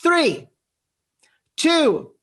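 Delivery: building countdown. Real, not acted. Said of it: "I'm gonna do it as loud as I can".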